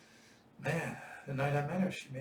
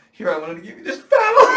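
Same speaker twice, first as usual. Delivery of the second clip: much higher and louder, breaking up with laughter; laughing through quote; 7 semitones higher, 18 dB louder than usual. Retold or imitated. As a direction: quote him while cracking up. Quoting friend, laughing.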